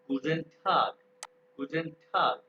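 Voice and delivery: Guten Tag said as गुजन ठाक with a long open aa; teacher models गुजन ठाक slowly for the class; German greeting. Shown as a demonstration. Teacher says the German greeting slowly to students. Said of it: "ये अभी अली ने बताया ठैग".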